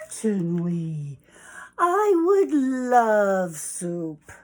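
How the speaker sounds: slow, hugely drawn-out, loud relish; gushing acceptance. Acted. Hen accepting soup from the fox, gushing and drawn out. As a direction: stretch every word with grand eagerness.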